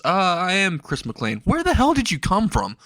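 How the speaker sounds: a hint of stunned disbelief; shocked. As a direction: sound floored someone just appeared. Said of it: "in a really shocked voice".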